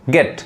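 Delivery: गेट as English "get", said quickly; quick, short-vowel गेट. Shown as a demonstration. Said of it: "fast पढ़ाएगा".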